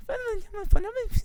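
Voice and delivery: very high, loud squeak; stretched-out high-pitched chipmunk squeak. Pretend. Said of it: "Sound like a goddamn Alvin and the Chipmunks".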